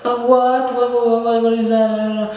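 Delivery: high, put-on girl voice; mock a baffled girl, repeating herself; confused girl. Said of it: "the girl is like".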